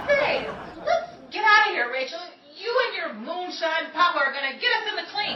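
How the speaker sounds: urgent. Acted. Betty hurrying Rachel away from trouble, urgent. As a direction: push her out the door.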